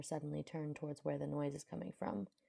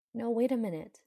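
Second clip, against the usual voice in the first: higher, louder urgent protest; urgent call; 7 semitones higher, 9 dB louder than usual. Acted. Mage calling after the departing prince, urgent. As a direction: call out suddenly to halt someone. She stops him from leaving.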